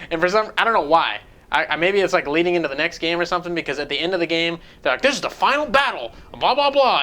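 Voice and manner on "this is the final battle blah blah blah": mock villain speech. Mocking game characters' dialogue.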